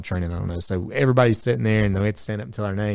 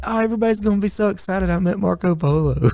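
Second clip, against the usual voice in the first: starstruck fan; 10 semitones higher than usual. Retold or imitated. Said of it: "he was like".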